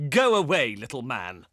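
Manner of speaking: normal talking voice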